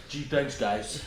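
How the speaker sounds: put-on a soft, off Canadian accent; a poor Canadian accent imitation. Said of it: "my Canadian accent is very off".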